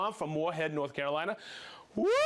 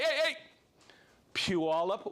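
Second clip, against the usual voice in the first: ordinary talk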